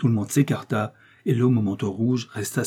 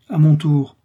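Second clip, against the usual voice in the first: trembling resolve; 6 semitones higher, 9 dB louder, slower than usual. Acted. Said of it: "tremblant lui-même".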